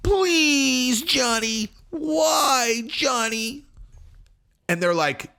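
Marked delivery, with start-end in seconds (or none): 0.0-3.7 very high wailing lunatic imitation; mock hysterical pleading. Wife pleading with Johnny after he left, mock hysterical. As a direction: wail and plead in exaggerated falsetto. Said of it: "You sound like a lunatic".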